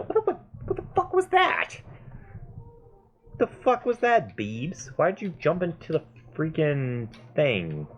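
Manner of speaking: high-pitched, startled swearing; what was that, in high alarm; startled outburst. Real, not acted. Player scolds Beebs for jumping into the thing, startled and high. Startled by game character's move.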